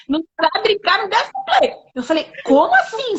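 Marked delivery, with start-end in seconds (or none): 0.1-1.7 comic complaint climbing to a high finish; mock protest. Woman repeats her complaint to Jesus, high mock protest. 2.4-3.2 high, startled mock question; retold incredulity. Woman retells questioning the Lord, high incredulous voice.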